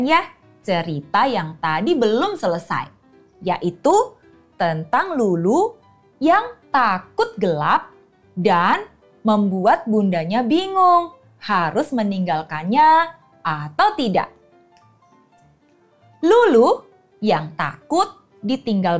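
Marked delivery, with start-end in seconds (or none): none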